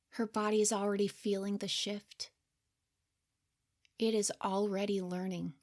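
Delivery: hushed, gentle third-person commentary, half whispered; soft narrator aside. A narrator's reading. Narrator looking back on her younger self, soft and hushed. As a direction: speak softly over the scene like a caring observer.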